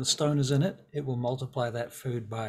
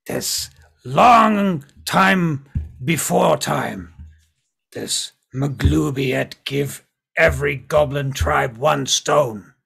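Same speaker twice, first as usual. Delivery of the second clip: loud, slow, heavy goblin speech; drawn-out, emphatic goblin voice; goblin storyteller; 9 dB louder, much slower than usual. Acted. Game master voices goblin telling lore.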